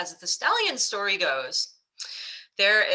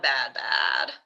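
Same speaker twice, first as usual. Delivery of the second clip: saboteur voice; 12 semitones lower than usual. Retold or imitated. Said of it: "Is it the saboteur that says this is bad".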